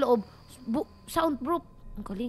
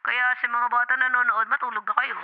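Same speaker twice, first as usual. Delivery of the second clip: lower, measured announcer read; mock broadcast advisory; 5 semitones lower, 5 dB louder than usual. Streamer warning young viewers to go to bed, announcer style. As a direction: deliver a mock parental warning like a TV notice.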